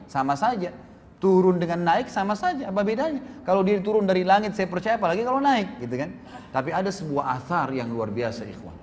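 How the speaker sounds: usual speaking voice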